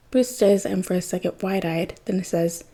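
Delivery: plain reading voice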